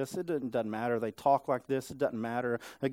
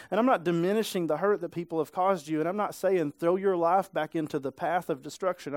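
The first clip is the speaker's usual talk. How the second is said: normal talking voice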